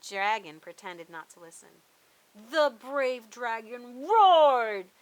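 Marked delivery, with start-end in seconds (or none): 2.3-4.9 slow, much louder roaring read; booming roar. Acted. Boy reading the story aloud, booming and slow. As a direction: boom out the roar. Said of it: "read aloud in a booming voice".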